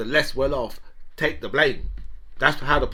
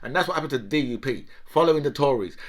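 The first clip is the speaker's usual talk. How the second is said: ordinary delivery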